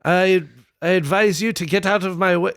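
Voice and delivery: put-on Scottish accent, halting start. King brushing off his advisors, in a Scottish accent. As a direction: have a go at a comic Scottish accent. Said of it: "I gotta do that in a Scottish accent".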